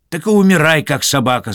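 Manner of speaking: high, loud indignant retort. A narrator's reading. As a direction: spit it out while walking away in anger. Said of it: "с негодованием удаляясь". (возмущённый громкий высокий ответ)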